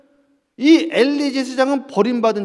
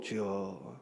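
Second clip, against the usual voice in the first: hushed prayer; 17 dB quieter, much slower than usual. Acted. Mock prayer sigh.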